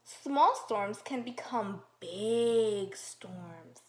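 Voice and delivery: slow, loud storm-page reading; dramatic read-aloud. Acted. Teacher reading storm page to children, dramatic slow voice. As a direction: build the storm for the kids.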